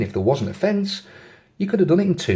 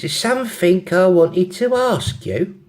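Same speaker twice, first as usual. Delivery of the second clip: put-on slow, high London accent; 7 semitones higher, 3 dB louder, slower than usual. London girl raising a question with her friend, London accent. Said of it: "have a go at the accents".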